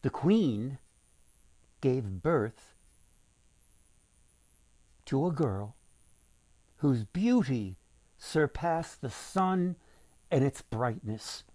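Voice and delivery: ordinary delivery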